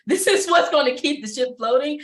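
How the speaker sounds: a real laugh bubbling under the words. Real, not acted. Speaker laughing about her brain keeping the ship floating, laughing.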